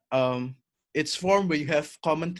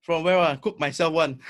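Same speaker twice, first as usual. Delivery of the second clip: playful Singlish, lightly put on; casual Singlish banter with a friend; Singlish accent; 4 dB louder than usual. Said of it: "let's exchange a few sentences in Singlish".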